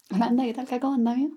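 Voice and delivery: "Holanda, ¿qué tal que cómo andamios?" rattled off very fast with a broad Chilean accent, voiced not whispered; stronger Chilean accent. Shown as a demonstration. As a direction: run the words together the Chilean way, "ket al ka koma ndamjo". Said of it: "O puedo decirlo con un acento más chileno".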